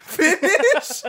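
high, laughing disbelief; incredulous. Real, not acted. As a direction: repeat it like you can't believe it. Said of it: "I've never heard finish".

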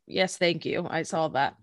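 dry, low sarcastic thanks. Retold or imitated. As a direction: roll your eyes at the obvious. Sarcastic retort to character.

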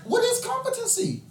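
ordinary talk, nothing marked

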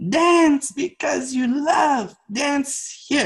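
high, slow, drawn-out mentor voice; Miyagi-like teacher. Retold or imitated. Teacher delivers his lesson to dancer, intense Miyagi-like voice. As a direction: imitate an intense master handing down a lesson. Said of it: "almost like a Miyagi moment".

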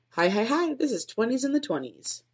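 high bouncy greeting into show name; upbeat podcast intro. Shown as a demonstration. Podcaster rehearsing her show intro, upbeat host voice. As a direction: sell it like a cheerful host.

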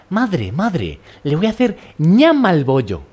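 put-on prissy child. A prissy Madrid boy telling his mother about the bun, high and mock-posh. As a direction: play a spoiled kid calling his mother. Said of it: "un niño muy repipi".

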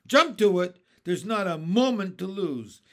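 loud hurrying command; urgent shout. Acted. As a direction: drive them to move now. James urging crew.

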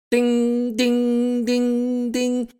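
loud, very high ringing dings; alarm-clock dinging. A narrator's reading. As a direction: imitate repeated phone alerts, high and piercing. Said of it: "turn his phone into an alarm clock".

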